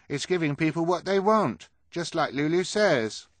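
high, loud character voice; arguing character. A narrator's reading. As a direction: argue back high and loud. Hugo argues back in story.

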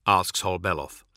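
ordinary delivery

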